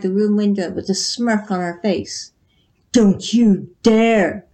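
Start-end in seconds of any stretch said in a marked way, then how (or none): 2.9-4.4 slow, louder angry shout; a king shouting a warning. A narrator's reading. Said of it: "shouted King Sultan".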